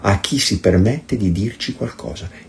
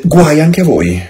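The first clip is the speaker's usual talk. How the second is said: louder, firm denunciation; raised warning voice; stern warning; 8 semitones higher, 5 dB louder than usual. Retold or imitated. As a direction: hurl the woe at them like a judgment. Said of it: "Gesù rispose".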